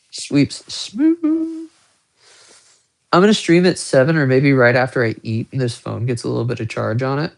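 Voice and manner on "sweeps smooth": squeaky show-off. Pretend. Showing off watch movement.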